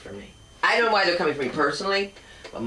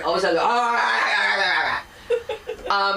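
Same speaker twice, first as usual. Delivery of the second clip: mock wailing cries; 5 semitones higher than usual. Mocking men's distress.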